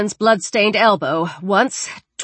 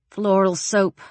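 ordinary delivery, nothing marked

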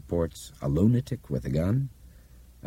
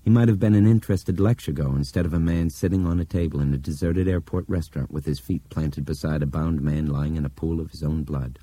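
ordinary delivery

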